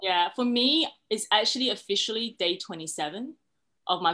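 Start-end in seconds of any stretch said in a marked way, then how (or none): none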